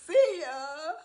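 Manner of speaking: high, slow coy character voice; Miss Booker voice. Acted. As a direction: purr it as the stage lady. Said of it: "Skillet and Booker".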